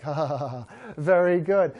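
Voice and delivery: breathy, low dog chuckle of bliss; mock contented panting laugh. Dog loving hours of petting, contented mock laugh. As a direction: laugh it low, then approve.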